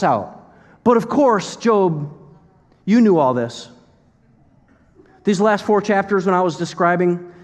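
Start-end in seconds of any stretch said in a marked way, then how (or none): 0.8-3.7 high, punchy mock agreement; loudly condescending; sarcastic. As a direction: lay on the sarcasm, starting high and falling. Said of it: "you ready for the sarcasm?".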